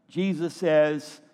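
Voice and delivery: usual speaking voice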